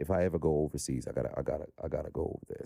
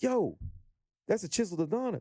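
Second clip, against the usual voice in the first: excited recognition; 14 semitones higher than usual. Acted. Guest imagining a fan recognizing him at DreamCon, excited recognition. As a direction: act like a fan spotting a celebrity. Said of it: "somebody just gotta recognize".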